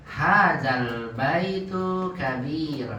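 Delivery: very slow, clear model read; slowly. Shown as a demonstration. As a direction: spell it out slowly for a struggling student. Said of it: "pelan-pelan". (diucapkan pelan-pelan untuk murid)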